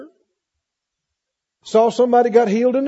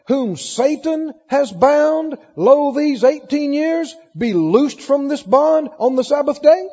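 preacher voicing Jesus' rebuke; 5 semitones higher than usual. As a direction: challenge the accusers in a higher, emphatic preaching voice.

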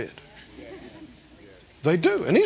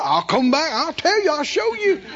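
rising mock defiance; 6 semitones higher than usual. Man promising, rising pitch.

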